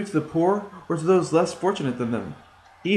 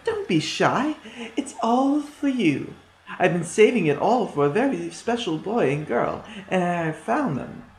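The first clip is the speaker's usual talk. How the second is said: coaxing like a sly witch; 5 semitones higher than usual. A narrator's reading. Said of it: "She said to them".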